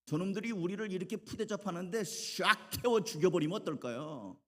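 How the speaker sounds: high-pitched acted indignation; angry disciples. Disciples complain to Jesus about rude villagers, acted indignation. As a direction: propose revenge, worked up but not shouting. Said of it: "화가 났어요".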